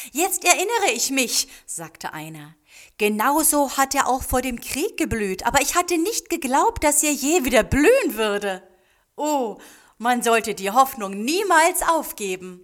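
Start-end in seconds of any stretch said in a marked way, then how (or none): 0.1-1.5 high, loud exclamation; excited recollection. A narrator's reading. Villager at the blossoming tree, excitedly remembering. 3.0-12.6 loud, high, carried-away villager; amazed exclamation. A narrator's reading. Villager sees the tree bloom like before the war, amazed.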